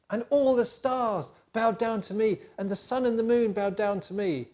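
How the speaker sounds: slow, high and grand, savouring every word; proud dreamer. Acted. Joseph boasting to his brothers that the stars bowed, grand and slow.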